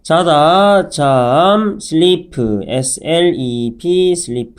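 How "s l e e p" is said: slow letter-by-letter spelling; slow teacher spelling. Shown as a demonstration. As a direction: spell out P E E L one letter at a time. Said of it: "spelling the word out".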